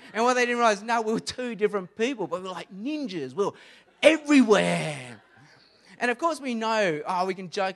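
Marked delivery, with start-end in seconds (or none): 2.3-5.2 dramatic ninja boast. Acted. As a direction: sell the ninja punchline big. Joke that they were ninjas.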